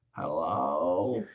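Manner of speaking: a low, soft, drawn-out hello; sleepy slow hello. Retold or imitated. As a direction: answer like someone slow and unhurried. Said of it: "I'm gonna slow way down with that person".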